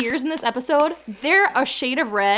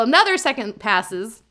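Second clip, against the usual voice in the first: normal talking voice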